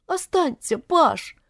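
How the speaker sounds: high-pitched, pitiful begging; plaintive plea. A narrator's reading. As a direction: plead for him to stay. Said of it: "жалобно протянула она к нему руки". (жалобно, высоким голосом)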